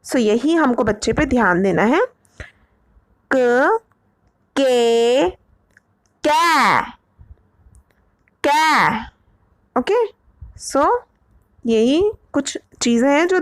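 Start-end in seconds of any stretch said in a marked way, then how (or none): 3.3-5.3 के with a straight e; pron demo. Shown as a demonstration. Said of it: "letter demos in sequence". 6.2-9.1 कै with an open ai and a faint ya glide; कै trailing into a slight ya; pron demo. Shown as a demonstration. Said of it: "contrasted with के".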